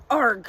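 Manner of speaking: frustrated wail. A narrator's reading. Collector clutches empty air, loud frustrated wail. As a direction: howl of defeat. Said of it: "the man wailed".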